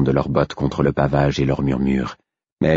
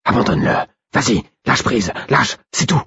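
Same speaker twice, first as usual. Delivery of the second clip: higher-pitched, urgent coaxing; urging inner voice; 7 semitones higher than usual. A narrator's reading. Wolf urges narrator to leave his body, urging. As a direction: press the listener to let go.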